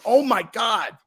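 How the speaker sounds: high-pitched, amazed exclamation; retold astonishment. Telling viewers about the money missed, astonished. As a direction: let the pitch leap in mock shock. Retold reaction to possible earnings.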